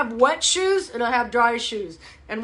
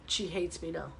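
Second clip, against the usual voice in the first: ordinary talk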